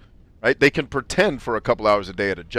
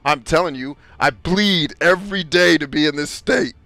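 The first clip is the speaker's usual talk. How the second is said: louder and higher, a hammed-up furious comeback; mock angry rant; 6 semitones higher, 5 dB louder than usual. Retold comeback to friend Ryan about platitudes, mock angry and loud. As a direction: blast him back in a mock rage. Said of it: "I said".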